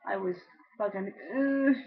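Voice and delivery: ordinary delivery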